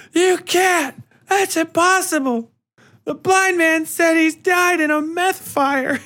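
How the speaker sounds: high, loud, hammed-up girl's disbelief; mock Star Wars denial. Daughter denies the man is her father, high and hammed-up. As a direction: ham up the dramatic denial. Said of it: "plays out just like fucking Star Wars".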